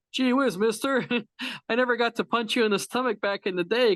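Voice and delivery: put-on high eager gee-whiz kid voice; eager young fan, fast and high; wholesome 1950s kid. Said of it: "this guy shows up and he's just like".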